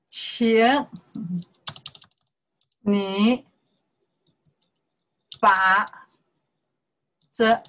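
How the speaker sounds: each syllable alone, drawn out, long pauses between; slow, emphatic pronunciation drill. Shown as a demonstration. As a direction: isolate every tone for learners to echo. Teacher drills syllables one by one.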